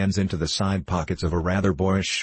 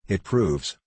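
ordinary delivery, nothing marked

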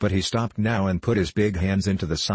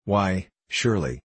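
usual speaking voice

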